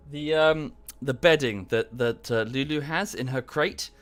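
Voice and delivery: ordinary talk, nothing marked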